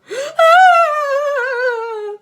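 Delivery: long, loud, high sobbing wail; huge wail. Acted. Teacher outside the classroom lets out huge crying wail. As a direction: howl out your heartbreak. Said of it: "they heard a huge wail".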